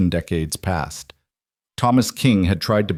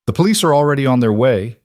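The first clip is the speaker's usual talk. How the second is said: louder and higher, pushing the warning out; said loudly; 5 semitones higher, 4 dB louder, faster than usual. A narrator's reading. Old man warns the men outside, loudly. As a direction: announce it firmly toward the intruders. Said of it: "he said loudly".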